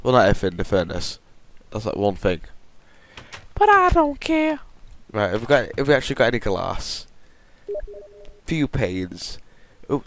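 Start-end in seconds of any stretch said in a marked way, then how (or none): 3.5-4.6 much higher, louder falsetto quip; squeaky silly voice. Pretend. Vlogger squeaking a careless aside while building. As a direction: toss it off in a cartoon squeak.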